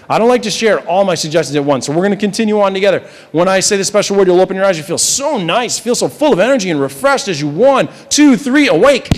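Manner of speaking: stage hypnotist's energizing count-up; hypnotic wake-up. Real, not acted. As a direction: rouse them from trance, swelling to the final word. Hypnotist counting subject awake.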